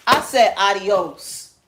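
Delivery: slow, loud drawn-out adios; mock shout. Telling someone to leave, slow loud shout. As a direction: bark it slowly at them.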